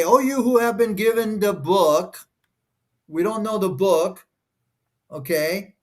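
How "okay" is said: ordinary talk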